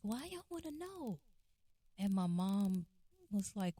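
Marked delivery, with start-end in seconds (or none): 0.0-1.2 high, wailing imitation; mock-upset, pitched-up complaint; whiny protest. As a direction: whine it like a pestered brother. Upset brother protesting, acted.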